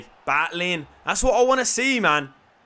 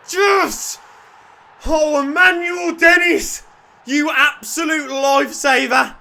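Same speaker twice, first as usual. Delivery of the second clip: loud, high, ecstatic yelling; real elation; goal celebration; 8 semitones higher, 11 dB louder, slower than usual. Real, not acted. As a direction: scream with joy at the goal.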